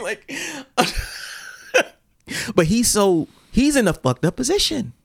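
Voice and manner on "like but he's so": normal talking voice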